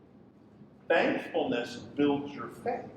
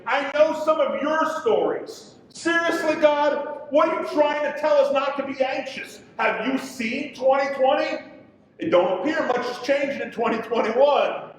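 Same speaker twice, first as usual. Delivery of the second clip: raised, higher voice pleading frustration at God; exasperated complaint to God; 7 semitones higher, 3 dB louder than usual. Acted. Preacher voicing the congregation's frustration, raised and exasperated. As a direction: throw the questions up at God, fed up.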